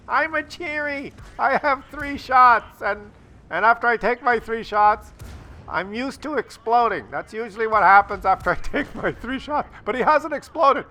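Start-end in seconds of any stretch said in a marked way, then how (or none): 0.1-9.7 high, chirpy voiced tank bragging; hammed-up tank talking about itself; voicing the tank. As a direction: play the tank as a proud little fool.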